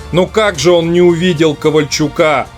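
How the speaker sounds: normal talking voice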